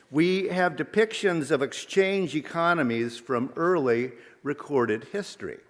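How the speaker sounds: normal talking voice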